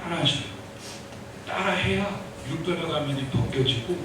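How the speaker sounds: ordinary talk, nothing marked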